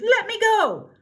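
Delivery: high, loud shout. Acted. Cindy shouting at the grabbing prince, high and loud. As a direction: yank free with a yell. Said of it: "As Cindy shouted".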